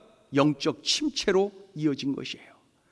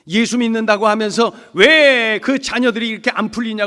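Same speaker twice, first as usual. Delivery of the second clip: raised-voice reproach, retold; mocking; 3 semitones higher, 7 dB louder than usual. Neighbours needling a believer about the children, mocking. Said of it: "그러면서 조롱하고 말이죠".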